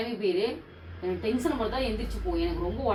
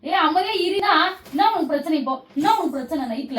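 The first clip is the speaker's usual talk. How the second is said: loud, high, furious yelling; angry shout; 6 semitones higher, 5 dB louder than usual. Real, not acted. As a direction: snap at him to shut up. Woken at night, yelling.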